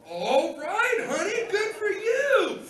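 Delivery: high, loud, hammed-up congratulations; excited cheering. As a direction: cheer your honey on in a drawn-out high holler. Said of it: "I was so excited for her".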